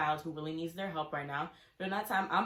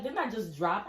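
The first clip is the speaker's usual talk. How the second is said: ordinary delivery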